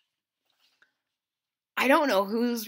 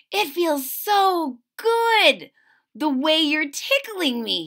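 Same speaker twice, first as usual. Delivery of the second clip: higher, slow and playful, a giggly leaf; 6 semitones higher, 4 dB louder, slower than usual. Acted. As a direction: drawl it out like a tickled leaf. Leaf character voiced in story.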